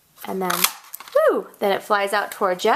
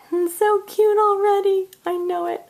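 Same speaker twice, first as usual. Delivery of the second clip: cute voice; 9 semitones higher than usual. Acted. Crafter gushing to viewers in her cute voice. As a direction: gush over the card in a high baby voice. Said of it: "I'm doing my cute voice".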